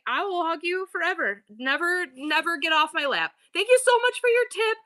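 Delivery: high, sweet, over-the-top doting; gushing high mock affection. Mom pretending to gush at her grown kids, high and sweet. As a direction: gush like a clingy mom thanking for a tip. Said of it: "I would be like".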